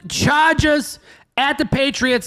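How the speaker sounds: high, ringing segment intro; big announcer voice, stretching the team names; matchup announcer. Pretend. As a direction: call out the next game like an announcer.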